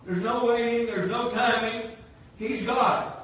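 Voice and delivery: full-force preaching. Acted. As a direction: preach it out loud to the congregation. Preaching peaks, shouting with laughter.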